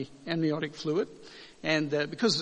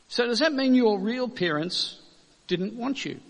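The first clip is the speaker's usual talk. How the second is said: lightly voiced little girl asking, higher pitch; a hint of a child's pointed question, raised in pitch; girl's question; 8 semitones higher, 5 dB louder than usual. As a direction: ask it higher, like a child quoting. Acted seven-year-old girl's blunt question.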